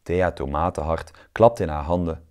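ordinary delivery

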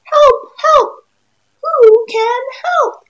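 high, loud cry for help; a stuck duck yelling high and loud; squeaky desperate duck shout; duck calling for help. Acted. Duck cries out in story.

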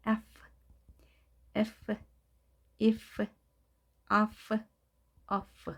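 "F, if, off" each ending in an airy English F sound; F with open lips. Shown as a demonstration. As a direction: finish "if" and "off" on a released F, lips open. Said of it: "입술을 열고 발음하는 거".

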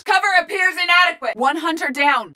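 high, urgent shouted report; combat callouts. Acted. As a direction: shout battle updates to the squad.

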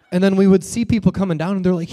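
usual speaking voice

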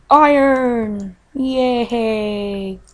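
usual speaking voice

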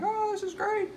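squeaky high mock cheer, near crying; hammed-up falsetto glee; mock wedding guest. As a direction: parody the happy guests in a shrill falsetto. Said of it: "They're like".

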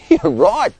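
high, loud, beaming agreement; grinning. A narrator's reading. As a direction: say it with a big grin. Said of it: "Sean grinned".

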